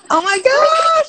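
high, loud delighted squeal; excited squeal. Real, not acted. Guest squealing as the host's baby appears.

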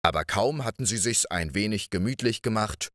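plain reading voice

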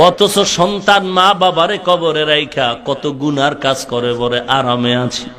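usual speaking voice

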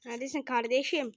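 usual speaking voice

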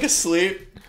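ordinary delivery

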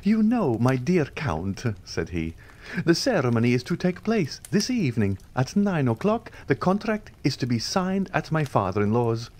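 gayest manner. A narrator's reading. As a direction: puff up with gaiety. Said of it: "Andrea assumed his gayest manner".

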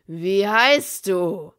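loud and slow demand. A narrator's reading. Bill demanding the boy's name, loud and slow. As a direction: drag out the demand. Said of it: "verlangte ... zu wissen".

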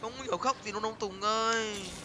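slow, long-held final syllables; drawn-out teasing call. Real, not acted. Streamer ribbing Tùng for crying, drawn-out teasing call. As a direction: call out teasingly, stretching the name and ơi right out.

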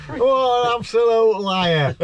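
amused mock outrage; laughing. Real, not acted. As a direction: chuckle the accusation out. Teasing after friend's fishing tale.

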